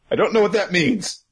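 usual speaking voice

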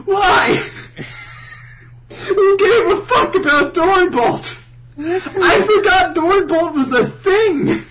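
high, loud comic rant; exasperated wail. Acted. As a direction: drag it out in wailing disbelief. Speaker's exasperated reaction to twist.

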